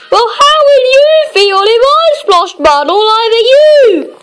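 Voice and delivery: lightly voiced angry man; mock shout. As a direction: shout it at Mister Happy, play-acting. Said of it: "he shouted at Mister Happy".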